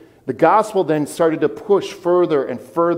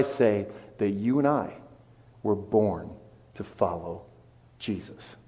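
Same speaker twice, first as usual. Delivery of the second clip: dropping much softer into a near whisper on Jesus; hushed reverence; 6 semitones lower, 10 dB quieter than usual. Real, not acted. Pastor to the congregation, dropping to a reverent hush. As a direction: let it fall to a hush.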